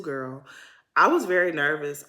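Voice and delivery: usual speaking voice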